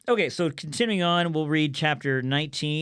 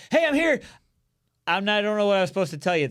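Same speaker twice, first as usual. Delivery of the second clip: high, louder mock excitement, tumbling into a rush; excited messenger; 6 semitones higher, 4 dB louder, faster than usual. Runner reporting to David with no real message, comically excited. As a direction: burst in eagerly, then laugh through the muddle. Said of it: "he was so excited to come and to share news".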